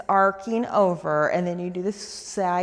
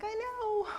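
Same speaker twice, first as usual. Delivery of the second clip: playful high body voice; 12 semitones higher, 8 dB quieter than usual. Pretend. Body part answers, playful.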